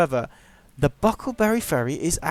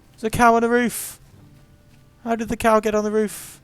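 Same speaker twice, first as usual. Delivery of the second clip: high, loud disbelief; mock astonishment; 9 semitones higher, 6 dB louder than usual. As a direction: exclaim it high and loud in comic bewilderment. Reacts to cow Easter egg.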